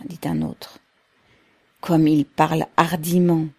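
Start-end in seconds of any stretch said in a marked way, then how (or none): none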